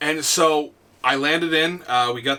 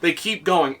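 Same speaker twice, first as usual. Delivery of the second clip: ordinary talk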